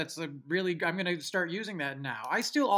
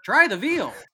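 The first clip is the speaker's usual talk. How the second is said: higher, louder punchline; catchphrase delivery; 5 semitones higher, 9 dB louder, slower than usual. Acted. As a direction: announce it proudly to a baker. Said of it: "you tell the baker".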